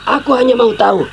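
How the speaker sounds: angry father ordering him away; stern anger. Acted. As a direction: lay down the law to the boy. Father lays down his order.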